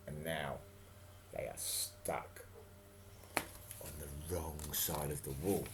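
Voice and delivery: hushed storyteller whisper; dramatic whisper. A narrator's reading. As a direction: whisper it like a secret. Storyteller drops to dramatic hush.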